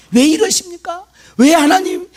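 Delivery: high-pitched mock protest to God; imagined complaint. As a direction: cry out "why" as if blaming God. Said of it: "원망 들고 불평도 막 그러겠죠". (원망하듯 높게 따지는 흉내)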